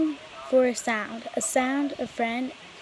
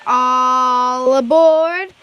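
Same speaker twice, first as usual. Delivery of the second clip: long, loud drawn-out call; conductor calling out; 11 dB louder, much slower than usual. A narrator's reading. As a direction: sing out the boarding call to the whole platform. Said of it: "the conductor cried out".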